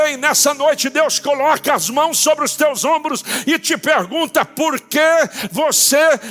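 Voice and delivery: high, emphatic questioning, words driven apart; impassioned pulpit cry, building to a shout; full-force preaching. Real, not acted. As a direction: challenge the congregation with each word.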